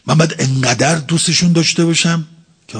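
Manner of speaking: ordinary talk, nothing marked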